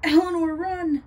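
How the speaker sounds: high, panicked shout; yelled warning. A narrator's reading. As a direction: yell for a friend to flee. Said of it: "he yelled out".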